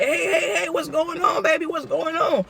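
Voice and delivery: high, caring call; concerned lady. Retold or imitated. Bystander lady asking the narrator what's happening. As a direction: call out like a worried auntie.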